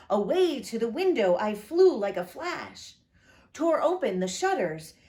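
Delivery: plain reading voice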